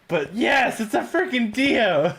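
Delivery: ordinary delivery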